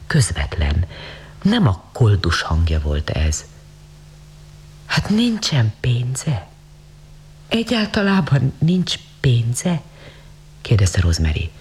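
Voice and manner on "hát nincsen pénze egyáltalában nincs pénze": slow, wondering question; amazed questioning. A narrator's reading. Rich lady questions the beggar, amazed and slow. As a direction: draw it out in mild disbelief, lifting higher.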